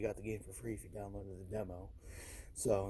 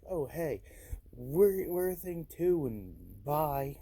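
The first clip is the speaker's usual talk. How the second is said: cheerful mock company voice; 5 semitones higher, 8 dB louder, slower than usual. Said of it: "Fortnite kinda was like".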